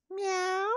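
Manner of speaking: high mewing cat sound; cat meow. Acted. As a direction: mew like a playful kitten. Teacher imitates animal sounds.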